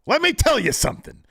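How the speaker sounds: high, loud cocky wrestling promo threat; menacing promo. Retold or imitated. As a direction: cut a heel promo at the camera. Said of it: "M J F turns the camera and says".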